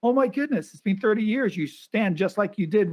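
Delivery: high, gushing imitation of her delight; excited woman. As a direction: pitch up to play her thrilled recognition. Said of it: "And she goes".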